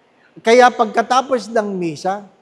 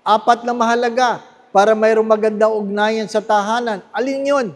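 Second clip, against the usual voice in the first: ordinary talk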